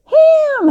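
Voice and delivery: "Ham!" squeaked very high and loud; her high "Ham!". Retold or imitated. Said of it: "I can't even do it as high as she did it".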